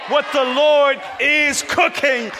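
loud, high, shouted preaching to the congregation; full-force preaching. Acted. Pastor rousing the New Bedford congregation, shouting. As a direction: preach it out, lifting the room.

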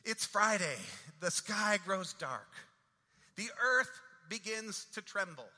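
rising and falling preacher's cadence, building to loud peaks; preaching. Retold or imitated. Pastor preaching the Friday sermon to his church, rolling cadence.

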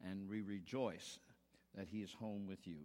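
soft, low prayer voice. Acted. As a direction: pray it quietly and reverently. Opening prayer before sermon.

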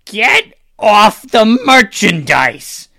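high, loud, drawn-out holler; unseen vendor yelling. Acted. Unseen merchant to bird scrambling on the goods, high angry yell. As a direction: yell it slowly, warning someone off your wares. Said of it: "you hear a voice say".

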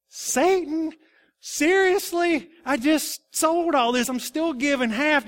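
high, loud, indignant self-defence; incredulous protest. Acted. Preacher plays Ananias defending his gift to Peter, incredulous.

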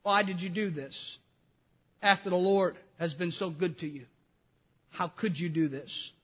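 soft, low, grieving reproach; a broken-hearted prophet confronting his king; sorrowful Nathan. Acted. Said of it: "the sadness in the voice of Nathan".

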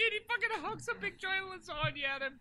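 high-pitched, squeaking with amusement; real laughing. Real, not acted. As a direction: squeal it out through giggles. Cracking up over own absurd story.

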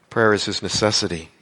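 ordinary talk

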